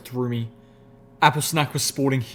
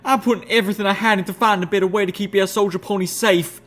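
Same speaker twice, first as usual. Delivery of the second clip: much higher, louder rage; raging; 8 semitones higher, 4 dB louder, faster than usual. A narrator's reading. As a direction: rage at them. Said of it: "Applejack raged".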